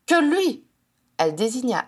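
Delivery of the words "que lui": high, indignant jab; accusing. A narrator's reading. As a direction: throw out an accusation. Janet accuses Edmund. (accusation lancée, voix aiguë)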